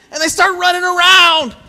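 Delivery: high, loud, carried-away storytelling; excited retelling. Real, not acted. Preacher caught up in child's joy.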